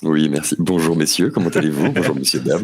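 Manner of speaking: low, smooth showman greeting; suave voice. Pretend. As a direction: lay on the smooth charm, low. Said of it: "avec ta voix suave".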